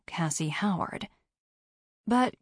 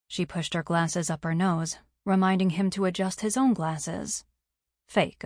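plain reading voice

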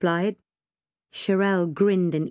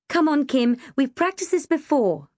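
raised-pitch encouragement; coaxing reminder; 8 semitones higher than usual. A narrator's reading. Girl reminding her panicking friend, coaxing. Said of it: "I reminded her".

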